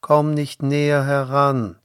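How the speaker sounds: loud, high, commanding call; voice from the fire. A narrator's reading. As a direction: warn him off in a ringing voice. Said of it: "sprach die Stimme".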